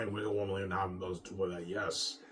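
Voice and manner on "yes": ordinary delivery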